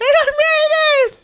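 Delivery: loud, slow, very high whale call; visiting whale. Acted. Whale arriving in his tank, loud high wail. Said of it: "I'll bring the whale in".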